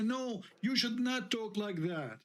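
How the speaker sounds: high, slow, scolding mimicry; mock scolding. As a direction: imitate their prim scolding, slow and high. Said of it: "all of them, they will say".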